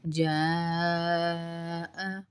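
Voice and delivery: جاء stretched very slowly on its madd; long madd. Shown as a demonstration. Teacher stretches جاء to show the madd. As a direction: hold the vowel of جاء. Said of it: "الف مدہ ساتھ ڈلا ہوا ہے۔".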